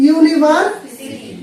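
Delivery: ইউনিভার্সিটি drawn out slowly, each syllable clear; slow careful pronunciation. Shown as a demonstration. Teacher to students, showing the word: slow, careful ইউনিভার্সিটি. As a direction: spell out ইউনিভার্সিটি slowly for the class. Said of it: "তারপর কি বললাম?".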